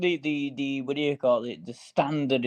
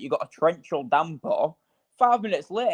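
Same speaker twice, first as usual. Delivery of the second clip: normal talking voice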